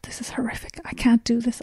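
broken whispered thought; despairing whisper. Retold or imitated. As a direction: whisper it as if to yourself, beaten.

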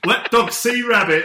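put-on broad northern accent, high and loud. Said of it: "I'm gonna really ham up my comical northern accent".

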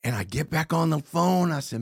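ordinary talk

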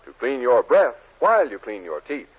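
usual speaking voice

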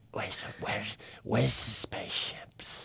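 hushed, slow mock-puzzled whisper. Donald to his host during the film, whispered. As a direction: imitate a confused filmgoer whispering.

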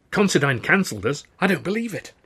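shocked, climbing protest; incredulous. A narrator's reading. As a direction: refuse to believe the news. Disbelief at the cancellation.

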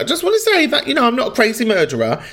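high, quick mock innocent voice; mimicking Fran. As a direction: parrot her like a nervous suspect. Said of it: "where you're like".

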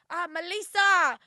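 slow, high, booming call; loud mum impression. Imitating mum calling her on the tube, loud high holler. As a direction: holler the name across a train carriage. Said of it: "she's so loud".

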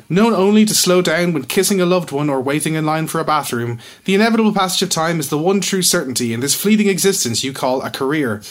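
slick commercial read; ad announcer voice. Pretend. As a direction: sell it like a TV ad. Reads a mock commercial.

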